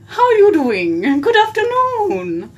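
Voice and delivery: slow, high, swooping British hostess; drawn-out, hammed-up British greeting; British lady. Greeting viewers on request as a mock British lady. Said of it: "Can you please talk like that".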